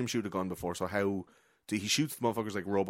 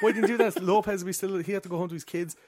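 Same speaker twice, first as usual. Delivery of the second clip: high, loud, hammed-up protest; mock exasperated complaint; 10 semitones higher, 4 dB louder than usual. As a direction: plead indignantly on a dead friend's behalf. Acting out friends' exasperated complaint.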